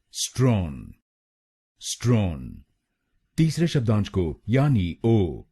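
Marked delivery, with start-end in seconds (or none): 0.1-2.6 स्ट्रॉन said as strɒn, one crisp syllable; syllable demo. Shown as a demonstration. Teacher drills second syllable for students, careful demo. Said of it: "हम बोलेंगे".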